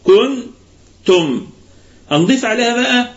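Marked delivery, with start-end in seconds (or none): 0.1-1.5 "كنتم" very slow, lips spread on the نون and the word split in two halves; lips spread on nun. Shown as a demonstration. Teacher modelling the correct "كنتم", lips spread on the nun. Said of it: "تقسم الكلمة إلى نصفين".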